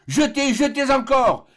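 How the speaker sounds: high, loud, slow drawn-out shout; last desperate cry. Acted. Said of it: "s'écria une dernière fois le docteur".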